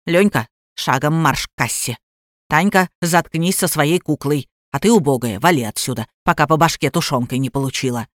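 low-pitched bossy woman threatening; domineering aunt, fed up. A narrator's reading. Aunt orders husband, child and stranger about, low and bossy.

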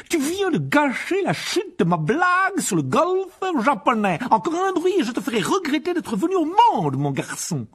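loud, high, slow furious threat; furious uncle. Acted. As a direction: bellow the threat right in his face.

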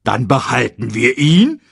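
etwas lauter, hoffend als Warzenschwein; hoffnungsvolle Frage. A narrator's reading. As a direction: Pumba fragt voller Hoffnung. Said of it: "fragte Pumba". (leicht gespielter Pumba)

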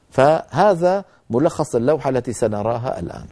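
ordinary talk, nothing marked

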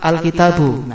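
Al-kitabu, long TA then bare BU; nunation dropped. Shown as a demonstration. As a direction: say Al-kitabu with no N at the end. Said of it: "kasih al menjadi apa". (Al-kitabu dengan al di awal, tanpa bunyi nun di akhir)

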